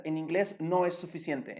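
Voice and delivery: ordinary talk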